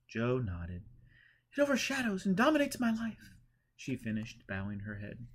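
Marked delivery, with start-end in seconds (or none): 1.5-3.3 much higher, trembling, high-strung pleading; fearful Eleanor. A narrator's reading. Eleanor confessing her fear to Joe, much higher. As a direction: beg for help in dread.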